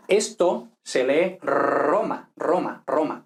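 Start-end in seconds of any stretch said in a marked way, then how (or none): 1.4-3.2 Roma with a long trilled rr; trilled Spanish rr; strong Spanish R. Shown as a demonstration. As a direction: roll the R of Roma hard. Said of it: "con la R fuerte".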